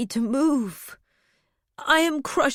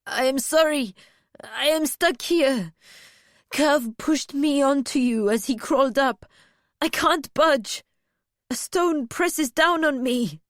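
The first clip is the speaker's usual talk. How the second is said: on the edge of panic, apologising; near hysteria; 4 semitones higher, 3 dB louder than usual. A narrator's reading. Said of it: "He was on the edge of hysteria".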